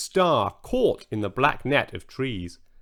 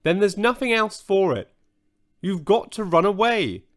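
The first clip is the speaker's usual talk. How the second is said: firmly insisting; 7 semitones higher than usual. A narrator's reading. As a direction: say it with firm resolve. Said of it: "said Kia firmly".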